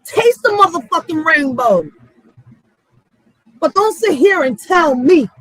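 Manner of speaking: normal talking voice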